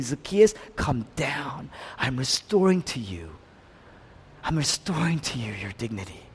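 soft and low, tenderly reassuring; Jesus speaking softly to Zacchaeus up the tree; gentle Jesus. Acted. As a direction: give him back his dignity quietly.

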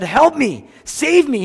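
high, loud plea for help; crying out. Retold or imitated. Preacher voicing Jesus crying out for rescue, loud and high. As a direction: cry out desperately. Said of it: "Jesus himself cried out and said".

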